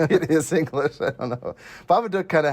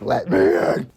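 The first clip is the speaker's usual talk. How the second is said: a hint of a creepy monster, louder; lightly voiced Babadook creeping in; 5 dB louder, slower than usual. Voicing the Babadook in bed scene.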